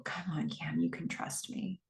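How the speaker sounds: retold plea, dropped to a whisper; very quiet. Joe's plea to Cam, quoted very quietly. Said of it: "He says very quietly".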